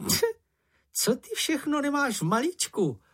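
high, squeaky little creature, full of admiration; admiring Sniff. A narrator's reading. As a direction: marvel at it in a high small voice. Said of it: "volal čenich s obdivem". (vysoký hlásek, obdivně)

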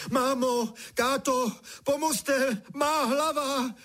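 very high, wailing cries for help; křik o pomoc. A narrator's reading. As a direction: cry out in alarm, dragging the last words. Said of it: "křičel, co mu hrdlo stačilo". (vysoký naříkavý křik o pomoc)

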